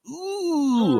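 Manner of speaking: awkward ooh. Retold or imitated.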